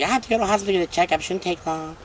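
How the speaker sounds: fast, lightly put-on girly voice; girl voice. Vlogger imitating heroine going for checkup, light girl voice. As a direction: rattle it off in a breezy girl voice. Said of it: "that's my girl voice".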